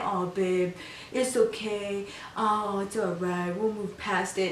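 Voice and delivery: imitating him cooing softly, a touch hammed-up; soft mock-tender comfort, poking fun; mock soothing. Mocking his soothing of the wife.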